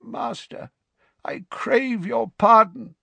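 a hint of a croak, high and slow; croaking servant. A narrator's reading. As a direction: beg pardon in a croak. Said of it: "croaked the man".

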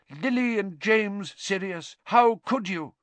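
high, anguished imitation of an accuser; retold sobbing. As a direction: imitate a distraught cry, pitched far up. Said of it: "They say he was sobbing".

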